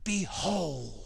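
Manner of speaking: 1 hushed Behold. Shown as a demonstration. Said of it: "It's like".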